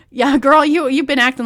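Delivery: loud sassy call; sassy shout. Real, not acted. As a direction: holler it like a friend.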